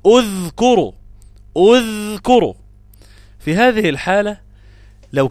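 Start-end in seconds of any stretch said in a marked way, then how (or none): 0.1-2.5 اذكروا begun with u, udhkuru, louder; اذكروا demonstrated loudly as udhkuru; opening hamza with damma. Shown as a demonstration. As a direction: open اذكروا on a rounded u. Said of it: "أقول".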